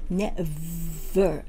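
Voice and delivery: "never" with a long buzzing V and muffled "er". Shown as a demonstration. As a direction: draw out the friction V in "never". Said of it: "ぶ、ぶではなくて". (「never」のVを摩擦させて発音)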